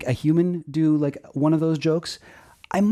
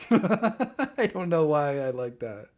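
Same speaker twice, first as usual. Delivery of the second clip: laughing; slower than usual. Real, not acted. Laughing at robot joke.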